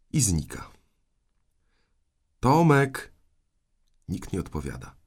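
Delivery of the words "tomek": put-on loud, raised call for the boy. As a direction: call out the name, drawn high and loud. Aunt calling out for missing boy.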